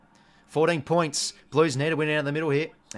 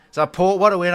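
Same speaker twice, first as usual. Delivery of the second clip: much louder, delighted call of the win; real thrilled win call; excited; 4 semitones higher, 11 dB louder than usual. Real, not acted. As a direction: call the result out with joy. Port win as the game ends.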